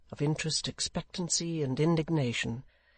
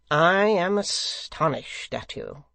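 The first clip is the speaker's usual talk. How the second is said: slow, drawn-out reproach; meek severity; 3 semitones higher, 5 dB louder, slower than usual. A narrator's reading. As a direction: scold gently but loudly, lingering on the words. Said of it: "with a kind of meek severity".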